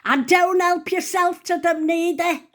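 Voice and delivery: high, loud shout from another room; imitating a neighbour yelling. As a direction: holler it high and scolding. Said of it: "she raised her voice for this bit".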